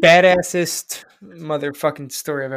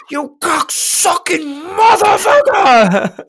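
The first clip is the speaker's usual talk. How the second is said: high, drawn-out bellow; all-out cursing shout; 11 dB louder, much slower than usual. Acted. Newly retired worker finally free to swear, shouting. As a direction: let loose a long, screamed insult.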